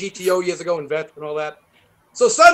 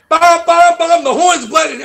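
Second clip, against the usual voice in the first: loud, higher "bum, bum" honks; horn imitation; 8 semitones higher, 7 dB louder than usual. Imitating his brother's stuck Camaro horn, loud honks. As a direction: blast out the horn noise.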